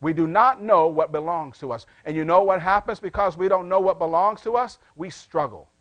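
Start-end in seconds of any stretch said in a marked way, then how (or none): 0.0-1.8 firm, full-voiced restatement; emphatic repeat. Real, not acted. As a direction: drive the point home, stressing not. Same point repeated at full voice.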